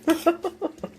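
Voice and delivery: short high giggle; a quick amused laugh; light laugh. Real, not acted. Laughs at own spoons.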